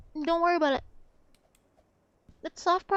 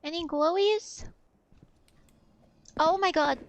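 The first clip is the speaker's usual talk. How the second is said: normal talking voice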